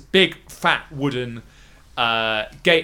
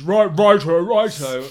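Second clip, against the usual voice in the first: guard agreeing; 5 semitones higher, 6 dB louder, slower than usual. Acted.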